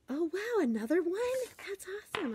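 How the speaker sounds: high, bubbly mock-delighted teacher friends; imitating gushing colleagues. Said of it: "My little teacher friends have been like".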